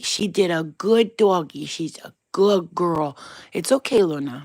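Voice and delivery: loud, drawn-out doggy talk; praising the dog. Real, not acted. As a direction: gush slowly to a good dog. Praising and soothing her dog.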